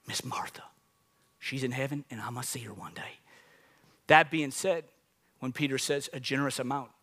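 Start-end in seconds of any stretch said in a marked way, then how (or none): 0.1-3.2 soft whispered remembrance; tender whisper. Real, not acted. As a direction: whisper it like a private memory. Tender memory of neighbor.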